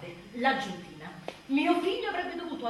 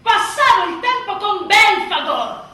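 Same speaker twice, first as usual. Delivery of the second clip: higher, louder, slow exclamation; exasperated exclamation; 7 semitones higher, 15 dB louder, slower than usual. Acted. Mother describing nights with her crying baby, loud and slow. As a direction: hit the punchline hard and slow.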